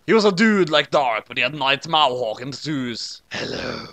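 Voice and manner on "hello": ordinary delivery, nothing marked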